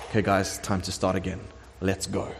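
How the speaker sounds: low, soft, understated call to action; quiet, casual nudge. Acted. God telling the builders to restart after sixteen years, quiet and casual. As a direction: gently get everyone going, very low-key.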